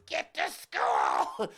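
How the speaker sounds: huge drawn-out mock-furious shout; very loud, stretched-out acted roar; mom flipping out. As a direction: bellow it like a mother who has lost her patience. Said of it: "my mom flipped out".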